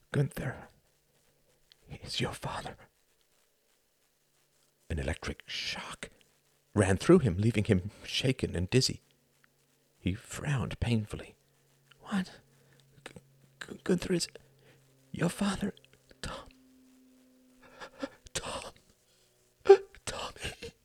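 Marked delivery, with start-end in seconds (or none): 0.1-2.8 a hushed, voiceless confession; whispered secret. Acted. Dying mother whispering the truth to her son. 12.0-20.7 high, breathless, stammering disbelief; stunned whisper. Acted. Son stunned to learn who his father is.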